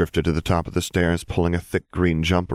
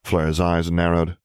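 ordinary delivery, nothing marked

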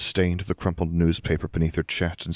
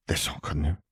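growled; 2 semitones lower, 5 dB quieter than usual. A narrator's reading. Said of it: "the witch growled".

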